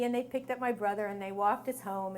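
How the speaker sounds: ordinary delivery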